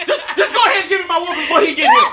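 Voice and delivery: laughing mock plea. Kid begging mama for whooping before daddy, laughing shout. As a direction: beg for the whooping, half laughing.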